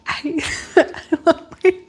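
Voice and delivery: words broken by a giggle; laughing. Real, not acted. Laughing about her dream.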